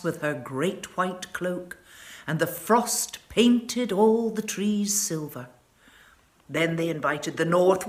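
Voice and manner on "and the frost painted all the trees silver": plain reading voice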